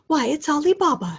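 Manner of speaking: loud, high burst of delight; ecstatic exclaim. A narrator's reading. Old Scrooge exclaims in ecstasy at childhood memory, ecstatic high cry. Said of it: "Scrooge exclaimed in ecstasy".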